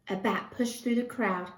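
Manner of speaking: ordinary delivery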